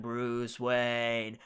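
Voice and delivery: low, slow, droning menace; creepy interrogation-room villain voice; Riddler impression. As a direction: draw the name out low and softly. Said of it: "And he's all just like".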